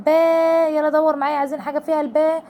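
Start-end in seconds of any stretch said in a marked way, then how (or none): 0.1-0.7 با held long and a little higher; drawn-out با for a child; long alif. Shown as a demonstration. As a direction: stretch با out clearly. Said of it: "كلمة بصوت الباء".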